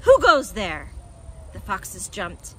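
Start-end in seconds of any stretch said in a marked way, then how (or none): 0.0-0.9 loud, sudden call from above; booming challenge. A narrator's reading. Unseen Badger challenging the foxes below, booming. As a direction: challenge an intruder loudly. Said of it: "a deep voice above their heads said".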